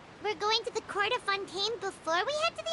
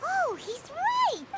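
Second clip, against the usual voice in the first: higher, drawn-out outburst; too-loud exclamation; 5 semitones higher, much slower than usual. Acted. Companion spotting the thief, excited outburst. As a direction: blurt it out, stretched and pitched up. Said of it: "keep your voice down".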